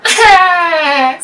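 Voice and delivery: long, loud groan sliding down; high, drawn-out wail of frustration; frustrated groan. Real, not acted. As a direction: cry out in dismay at a bad roll. Frustrated cry at a bad roll.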